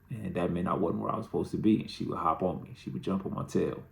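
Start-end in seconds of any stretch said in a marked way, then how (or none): none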